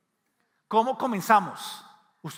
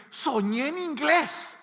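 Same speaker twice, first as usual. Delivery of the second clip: excited discovery; 9 semitones higher, slower than usual. Retold or imitated. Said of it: "usted se levanta y dice".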